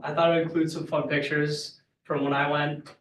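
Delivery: ordinary talk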